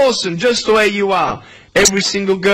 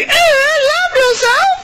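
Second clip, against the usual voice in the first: very high, squeaky mock disbelief; exaggerated squeaky scepticism; incredulous thinker; slower than usual. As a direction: put on a silly high-pitched voice of doubt. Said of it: "you're probably thinking".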